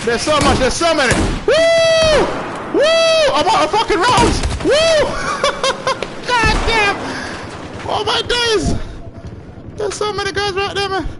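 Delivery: high, loud hyped shouting; excited yelling. Real, not acted. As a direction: whoop like you're swarmed. Overwhelmed by enemies in firefight.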